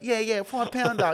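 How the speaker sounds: high, loud egging-on voice; mock goading. Bystanders urge her to take the money, mock goading.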